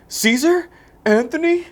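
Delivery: high, loud mock concern that doesn't care; jokey uncaring call. Hero calling to his exploded foes, mock concern. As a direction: call out in fake worry. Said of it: "he does it in this, like, joke way".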